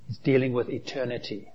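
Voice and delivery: normal talking voice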